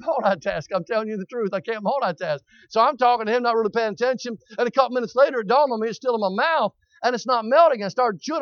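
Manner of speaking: normal talking voice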